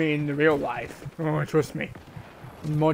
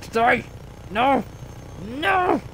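frantic cries; 5 semitones higher, slower than usual. Real, not acted. Gamer about to die in game, frantic higher cries. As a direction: cry out as you barely escape.

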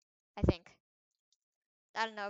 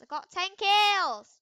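I got ten kills, belted out higher and slower; louder, higher, slow boast; 7 semitones higher, 10 dB louder, slower than usual. Real, not acted. Gamer bragging to viewers, loud slow boast. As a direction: shout the brag out slowly.

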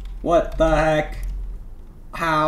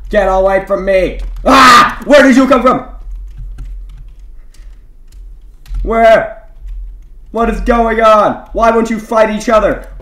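much higher and louder, frantic cries; panicked yelling; 4 semitones higher, 6 dB louder, slower than usual. Real, not acted. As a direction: scream in real panic as enemies swarm. Surrounded with no health, panicking.

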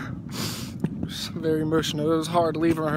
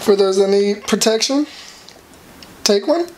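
mock announcer; 7 dB louder than usual. Rereading sign, laughing mean.

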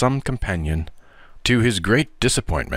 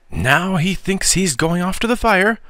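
to herself; 10 semitones higher than usual. A narrator's reading. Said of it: "soliloquized Miss Meadow".

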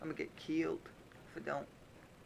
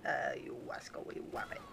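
a lightly voiced Elmer Fudd whisper, w for r; bad Elmer Fudd; 3 semitones lower than usual. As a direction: hunt the wabbit in a weak Fudd voice. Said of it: "the worst Elmer Fudd impression ever".

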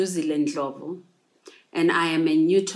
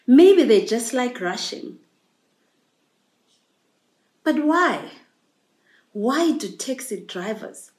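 emphatic, questioning poem delivery with long pauses; poetry recital; 2 semitones higher, 3 dB louder than usual. Acted. Poem reflecting on anxiety of taxi travel, emphatic recital. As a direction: recite it as insistent questions, swelling on key words.

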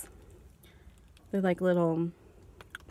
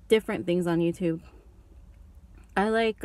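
ordinary delivery

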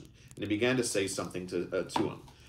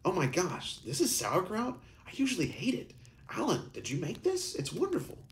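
high, delighted surprise; pitched way up in amazement; surprised exclamation; 12 semitones higher than usual. Acted. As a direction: Rebecca gushing with surprise.

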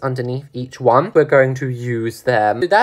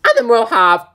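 suddenly much higher pitch; a squeaky jump up in pitch; louder, higher slip of the voice; voice shot up; 10 semitones higher, 5 dB louder than usual. Real, not acted. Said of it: "my voice just went up about twenty octaves".

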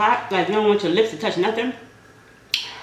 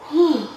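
loud whew from spice heat; blowing out the burn; hot-food exhale; 6 semitones higher, 5 dB louder than usual. Real, not acted. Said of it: "That mouth is on fire".

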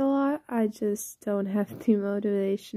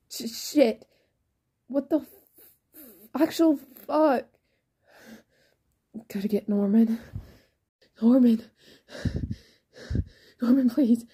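breathless, stammering panic; panicked; 2 semitones higher than usual. Acted. Ray calls Norman after listener collapses, panicked. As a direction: gasp and plead urgently for help.

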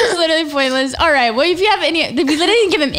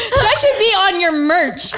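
slow giggling words; laughing; 5 semitones higher, slower than usual. Real, not acted. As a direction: laugh through it.

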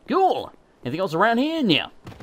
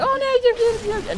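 mock reassuring fans. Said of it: "Everyone's like".

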